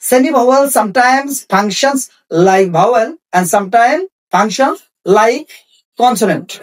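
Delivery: very slow, word by word; slow dictation. Shown as a demonstration. Teacher giving students the semi-vowel rule, very slow dictation. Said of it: "teacher dictating a rule".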